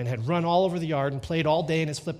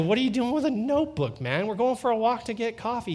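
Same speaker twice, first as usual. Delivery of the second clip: much higher, exasperated questioning; mock-scolding disbelief, pitched right up; incredulous dad; 9 semitones higher, 3 dB louder than usual. Father to son carrying a notebook, incredulous.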